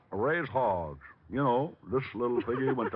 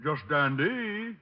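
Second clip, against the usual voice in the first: broad country drawl; hillbilly put-on; 3 semitones higher, much slower than usual. Detective in disguise calling the psychic, country accent. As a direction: play the hog farmer yokel.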